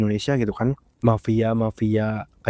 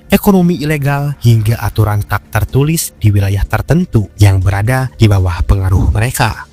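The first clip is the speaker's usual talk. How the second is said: loud, steady documentary voiceover; documentary narration; 8 dB louder than usual. Acted. Documentary narration over music.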